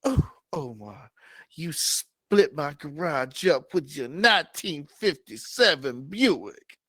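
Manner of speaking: swoon, then catch yourself; Sally gushing. Acted. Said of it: "She goes".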